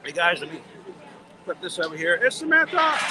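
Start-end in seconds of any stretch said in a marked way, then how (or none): 2.2-3.0 high, loud happy reveal; excited announcement. Real, not acted. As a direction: present her with delight. Announcing a friend to viewers.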